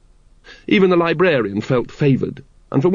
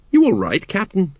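mildly worried check on a superior; concerned; 7 semitones higher than usual. A narrator's reading. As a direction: ask with concern, lifted in pitch. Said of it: "all concerned".

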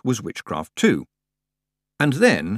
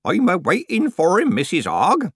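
put-on high, loud, cheery old countryman; cheerful old man; 10 semitones higher, 4 dB louder than usual. A narrator's reading. Old man answers Nanny from his bed, cheerful. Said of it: "said the old man cheerfully".

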